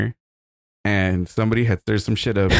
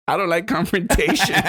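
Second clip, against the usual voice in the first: higher, whiny mock voice of a scared friend; mocking timid friend; 9 semitones higher than usual. Mocking the friend who stayed seated during the fight, higher and whiny. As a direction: whine it like a coward making excuses. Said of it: "And he's like".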